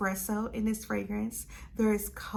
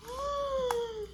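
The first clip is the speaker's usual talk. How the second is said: high, theatrical intake of breath; dramatic gasp; 4 dB quieter than usual. Real, not acted. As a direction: gasp mid-reveal for effect. Gasp inside the dramatic reveal.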